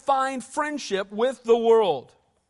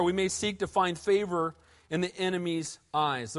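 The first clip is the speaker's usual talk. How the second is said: ordinary talk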